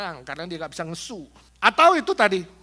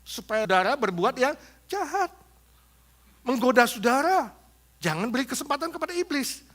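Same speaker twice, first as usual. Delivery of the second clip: usual speaking voice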